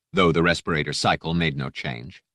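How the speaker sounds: ordinary delivery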